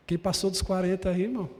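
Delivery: usual speaking voice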